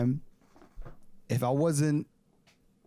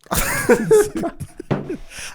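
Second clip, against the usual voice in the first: big high burst of laughter; cracking up loudly; 9 dB louder than usual. Real, not acted.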